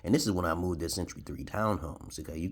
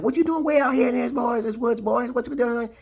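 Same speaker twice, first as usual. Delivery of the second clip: imitating his drawl; 8 dB louder than usual. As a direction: ham up the drunk man's way of talking. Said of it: "That's the way he talked".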